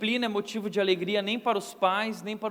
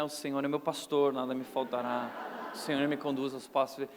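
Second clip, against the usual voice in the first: mock pious recital; 8 semitones lower, 6 dB quieter than usual. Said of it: "eu começava a recitar do lado dele".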